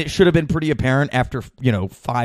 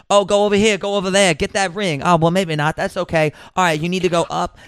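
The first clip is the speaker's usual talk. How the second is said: higher-pitched mock-eager attendant, put-on Scandinavian accent; enthusiastic jabbering; 6 semitones higher, faster than usual. Booth attendant coaching a player on camera, imitated enthusiastic jabbering. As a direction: mimic her chattering eagerly into the player's ear. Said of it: "she got enthusiastic".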